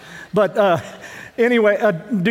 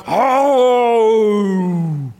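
a drawn-out, half-hearted mock roar; poor lion roar imitation; 3 dB louder than usual. Preacher mimics a TV lion's roar for the congregation, weak mock roar. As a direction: imitate a lion coming out, badly on purpose. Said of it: "It's a poor imitation".

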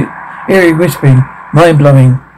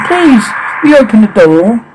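put-on higher childlike plea; child's voice; 7 semitones higher than usual. Ghost child at the door asking to be let in, sweetly eerie. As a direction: ask sweetly like an eerie little kid. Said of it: "Children's voice".

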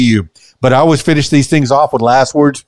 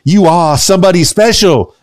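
ordinary talk, nothing marked

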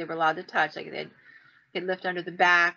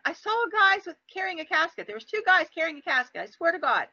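high-pitched, worked-up insistence; insistent protest; 9 semitones higher than usual. Retold or imitated. Retold insistence to doubting friend.

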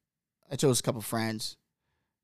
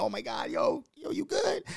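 high, softer mock-concerned voices; alarmed onlookers; 6 dB quieter than usual. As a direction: imitate worried bystanders checking on him. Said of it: "everybody went".

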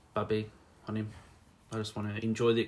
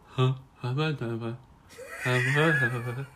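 dumbstruck wordless babbling; starstruck; 3 semitones higher than usual. Acted. Fan acting out meeting their idol, starstruck. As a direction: stammer as if too starstruck to speak.

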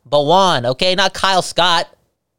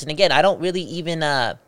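usual speaking voice

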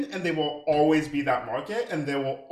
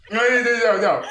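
laughing protest; 6 semitones higher, 9 dB louder than usual. Real, not acted.

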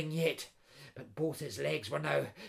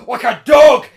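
angry threat building to a shout; crying out; 5 semitones higher, 19 dB louder than usual. A narrator's reading. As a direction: start firm, erupt into a loud, higher cry. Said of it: "cried the intruder".

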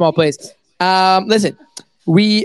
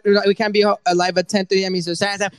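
usual speaking voice

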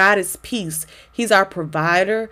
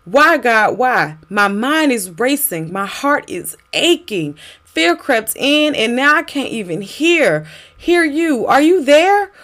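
pleading prayer; 4 semitones higher than usual. Acted. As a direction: cry out to God in distress.

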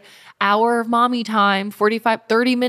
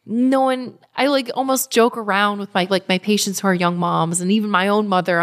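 usual speaking voice

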